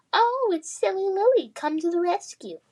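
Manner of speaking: mock her rescue in a high taunt; jeering. Adam jeering at Lily, high mocking voice. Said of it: "Adam jeered".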